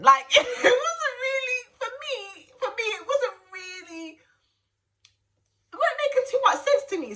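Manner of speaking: high laughing voice, half crying; real laughter cracking the voice. Real, not acted. Laughing retelling the shock.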